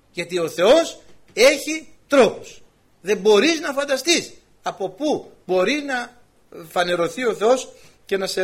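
preacher's slow weighty emphasis; slow emphatic preaching. Real, not acted. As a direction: press the point slowly and loudly.

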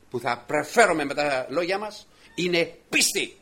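ordinary talk, nothing marked

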